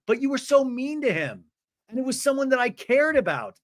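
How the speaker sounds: high plaintive put-on voice; mock-wounded, pitched well up; Brittany. Woman defending a man to her friend, in high mock hurt. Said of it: "And Brittany's like".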